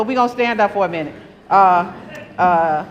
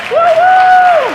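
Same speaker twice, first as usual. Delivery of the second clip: cheering whoop; 10 dB louder than usual. Real, not acted. Cheering a named chair.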